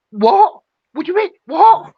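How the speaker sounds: high, loud disbelieving what; incredulous what. Retold or imitated. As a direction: throw back a stunned what. Outraged disbelief at accusation.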